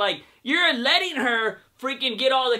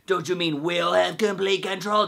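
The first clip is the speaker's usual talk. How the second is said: Tabitha impression; 2 semitones lower than usual. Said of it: "And she was like".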